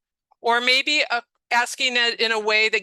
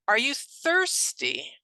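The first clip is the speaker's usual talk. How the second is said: higher, drawn-out simple question; slow patient question; 6 semitones higher, slower than usual. Shown as a demonstration. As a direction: ask very slowly, giving time to understand. Models question with gesture to patient.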